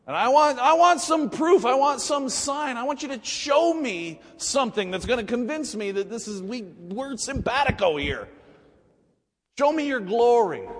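high, loud demanding; insistent shouted demand; lathered-up Moses. Acted. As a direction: press hard for proof, pitch way up. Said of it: "Moses is so lathered up".

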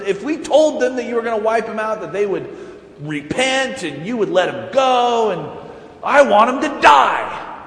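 high, loud, aggrieved shouting; indignant outburst. Acted. Jonah yelling at God, high and loud in indignation. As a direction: rant like a spoiled prophet.

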